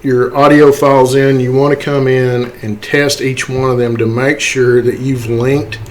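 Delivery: normal talking voice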